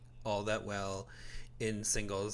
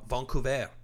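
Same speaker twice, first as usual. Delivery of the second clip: Vancouver said the French way, "von-coo-vay"; French pronunciation; 2 semitones higher, 3 dB louder than usual. Shown as a demonstration. Host re-saying Vancouver for Canadian listeners, in a French accent. Repeats the city name French style.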